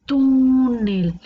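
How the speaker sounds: "túnel" with a loud, long TÚ falling into nel; exaggerated stressed syllable. Shown as a demonstration. Teacher proving the penultimate stress, loud drawn-out TÚ in "túnel". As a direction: punch and stretch the TÚ in "túnel". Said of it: "la sílaba tónica en la penúltima".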